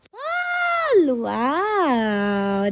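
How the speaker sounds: shocked squeal at a viewer's gift; surprised. Real, not acted. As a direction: squeal it out in amazement. Surprised reaction on stream.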